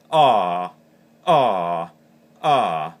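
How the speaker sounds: loud open ahs letting the air out; open-hand goose moan series; relaxed goose moans. Shown as a demonstration. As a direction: relax out and dump the air on each ah. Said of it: "as your breath comes out".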